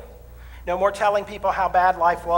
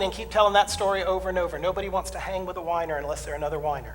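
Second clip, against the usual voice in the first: normal talking voice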